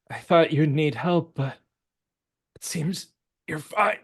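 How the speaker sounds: a hint of a breathless stranger; exhausted NPC voice. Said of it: "she says".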